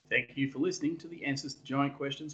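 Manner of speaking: polished podcast outro over music; outro announcer read. Acted. Host closing the show for listeners, announcer read. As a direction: read it like a radio sign-off.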